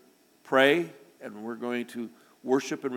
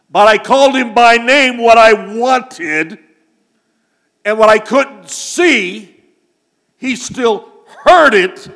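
loud, slow, higher preaching climax; preaching at full force; 7 semitones higher, 15 dB louder, slower than usual. Acted. Preacher tells the congregation the dog heard his call, booming. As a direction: drive the point home to the room.

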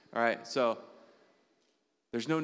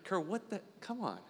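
higher, softer exasperated self-scolding; 8 semitones higher, 7 dB quieter than usual. Retold or imitated. Alone, scolding himself for struggling: exasperated. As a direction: scold yourself in a frustrated huff. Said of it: "I do this sometimes".

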